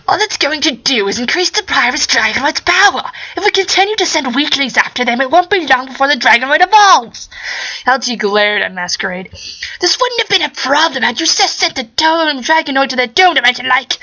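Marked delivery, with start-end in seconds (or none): 0.1-7.3 high, strained mad-scientist voice; scheming scientist squeezed high in the throat; Hal G voice. Acted. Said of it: "doing Hal G's voice hurts". 9.8-14.0 high, strained mad-scientist voice; scheming scientist squeezed high in the throat; Hal G voice. Acted. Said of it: "doing Hal G's voice hurts".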